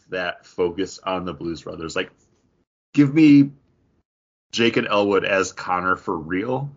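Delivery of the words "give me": ordinary delivery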